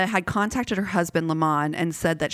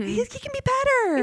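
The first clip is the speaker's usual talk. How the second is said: very high, put-on dreamy voice; mock lovesick excuse. Host mocking a forgiving partner in a high voice. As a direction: gush like a blind lover.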